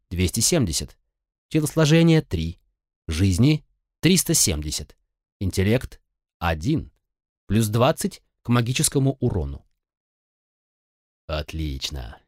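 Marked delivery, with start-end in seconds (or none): none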